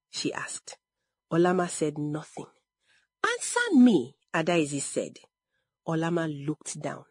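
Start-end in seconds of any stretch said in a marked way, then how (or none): 3.2-4.2 higher, insistent command; stern demand. A narrator's reading. Adaize confronting silent Olama, stern demand. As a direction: demand an answer, voice raised in pitch.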